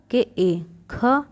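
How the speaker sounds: drawn-out spelling of केए then a clear ख; teacher spelling aloud slowly for kids; slow spelling demo. Shown as a demonstration. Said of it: "क का क्या होगा?".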